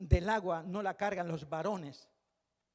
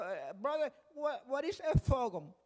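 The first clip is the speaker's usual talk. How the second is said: mock English accent, higher and slower; English-speaking sister; 5 semitones higher, 3 dB louder, slower than usual. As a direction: mimic a puzzled English-speaking churchgoer asking a question. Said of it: "una hermana cuando acabó me dijo".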